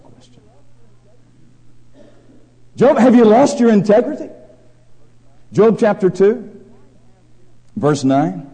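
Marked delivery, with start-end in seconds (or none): 2.8-8.5 loud, high sermon voice, pressing each phrase; full-force preaching. Acted. Preacher to congregation, loud fervent preaching. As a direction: thunder it from the pulpit.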